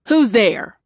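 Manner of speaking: wife yelling at hidden listeners; yelled. A narrator's reading. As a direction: call out sharply into the dark. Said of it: "Rachel yelled".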